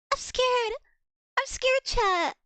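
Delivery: high, loud mock-scared squeal. Real, not acted. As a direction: squeal it to chat in playful fear.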